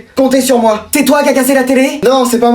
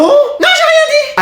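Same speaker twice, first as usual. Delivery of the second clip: slow, very high sing-song denial; innocent backpedal; slower than usual. Acted. Student backpedaling to the teacher, sing-song high innocent denial. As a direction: pretend you said nothing, high and drawn out.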